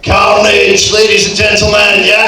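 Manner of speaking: loud showy announcement; announcer shout. Acted. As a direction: hype the name to the crowd. Host announces the character.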